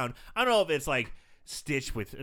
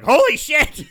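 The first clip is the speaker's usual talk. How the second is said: high, loud mock shock; 11 semitones higher, 12 dB louder, slower than usual. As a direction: blurt it out stunned. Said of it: "convinces Ben of like".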